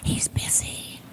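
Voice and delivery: tiny whispered no; hushed child's whisper. Retold or imitated. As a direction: whisper it like a small child hiding. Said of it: "And still whispering".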